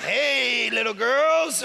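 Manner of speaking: a hint of a creepy stranger in a van, lightly voiced; mock creepy stranger. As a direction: play a joke creep calling out to kids. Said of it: "looked like a creep".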